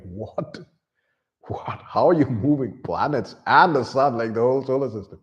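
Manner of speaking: baffled, asking how planets can move; mind blown disbelief. Retold or imitated. Crew stunned at the moving solar system, building amazement. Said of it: "They're like".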